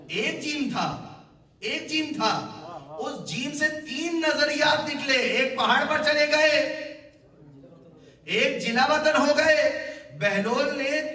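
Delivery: high, loud sermon climax, stretched endings; full-force preaching. Real, not acted.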